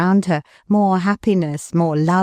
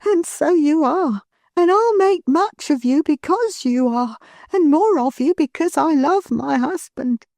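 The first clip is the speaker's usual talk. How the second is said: put-on higher, gentle young wife's voice; Milly, tender; 10 semitones higher than usual. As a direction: lovingly reassure her husband. Milly speaks to her husband.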